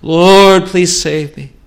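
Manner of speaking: loud, high cry of "Lord, Lord"; pleading sinner's prayer. Acted. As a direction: cry out like a desperate sinner begging to be saved.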